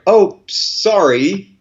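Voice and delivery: exaggerated, slow insincere regret; sarcastic apology. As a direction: stretch it slowly into a fake apology. Mock apology to offended New Zealander.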